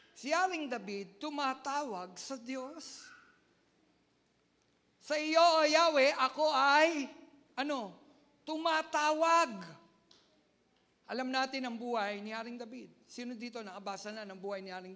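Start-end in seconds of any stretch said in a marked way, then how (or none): 5.0-9.7 much louder, slow psalm proclamation; preacher declaiming King David's call; preaching at full force. Real, not acted. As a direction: proclaim the psalm slowly and loud.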